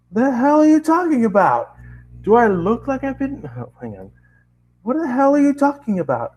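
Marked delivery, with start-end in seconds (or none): 0.1-3.5 screaming back. Acted. Narrator screams back at the scientist, indignant. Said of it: "I scream back". 4.8-6.3 screaming back. Acted. Narrator screams back at the scientist, indignant. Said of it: "I scream back".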